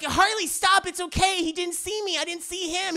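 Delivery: high, urgent retold plea; mock pleading woman. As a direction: plead with him to stop, acted. Woman pleads to stop fight.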